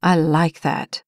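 slow, low suggestive purr; Tucker suggestive. A narrator's reading. Tucker teasing.

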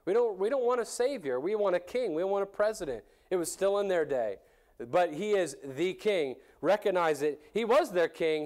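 normal talking voice

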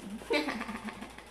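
short soft chuckle; laugh. Real, not acted. Ri depois de falar do riso. (risadinha baixa)

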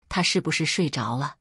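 ordinary delivery, nothing marked

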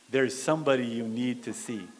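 low, soft, firm reply as God; quietly refuse, no argument; hushed divine instruction; still small voice. Acted. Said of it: "still small voice of God".

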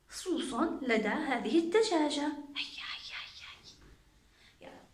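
hushed, secretive whisper; soft unvoiced whisper. Shown as a demonstration. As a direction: whisper it like a shared secret with children. Hushed counting with kids.

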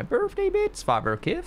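slow, high swoop on the birthday bits; odd delivery. Real, not acted. Streamer reading out a viewer's birthday bits, oddly said. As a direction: swoop up oddly, then drop back. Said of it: "I don't know why I said it like that".

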